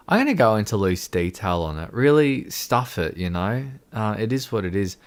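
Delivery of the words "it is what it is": normal talking voice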